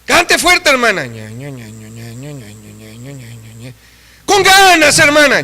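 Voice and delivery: urgent preacher's call. Real, not acted. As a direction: rouse the sisters to sing. Calling out to congregation.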